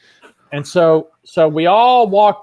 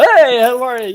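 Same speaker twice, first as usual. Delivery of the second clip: brighter, louder, higher chummy greeting; imitating Michael Goudeau; 6 semitones higher, 6 dB louder than usual. [name] playing Michael at the bows, louder chummy greeting. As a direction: ham up a friendly juggler. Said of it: "I'm like Michael Goudeau".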